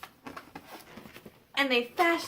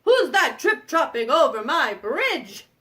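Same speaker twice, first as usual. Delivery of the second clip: loud, slow troll challenge; unhappy troll; 7 dB louder, slower than usual. Acted. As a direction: groan "oh", then stretch out the loud demand. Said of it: "the troll goes".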